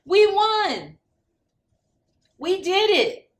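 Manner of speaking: high, loud victory shout; joyful cheer. Acted. Dogs celebrating the mayor's win, high excited cheer. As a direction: yell it out in triumph.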